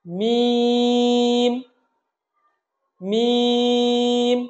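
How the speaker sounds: মিম chanted long and level; dry-lip articulation. Shown as a demonstration. Teacher models for repetition, মিম drawn out. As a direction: hold মিম on one note, closing the lips. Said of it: "ঠোঁটের শুকনো অংশ থেকে".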